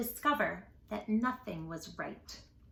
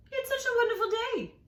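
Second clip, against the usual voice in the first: much higher, delighted; cheery lemur; 12 semitones higher, faster than usual. Acted. Lemur cheering Jim, high and delighted. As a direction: gush about the day.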